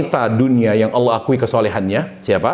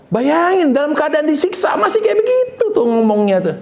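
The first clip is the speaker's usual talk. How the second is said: amazed; 11 semitones higher than usual. Real, not acted. Preacher marvels, voice raised high.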